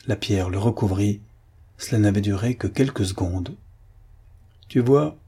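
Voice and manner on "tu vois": higher, mildly exclaimed gloating; a touch of triumphant exclamation; exclaiming. A narrator's reading. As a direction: crow it a little, pitched up. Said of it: "s'exclama Siméon".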